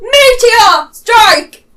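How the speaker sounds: drawn-out battle cry, high and loud; Doomfist. Acted. Player mimicking a game hero announcing his big attack, mock battle cry. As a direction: bellow the attack name very slowly. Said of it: "the fact that he's like".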